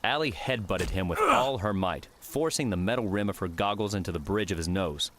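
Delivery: plain reading voice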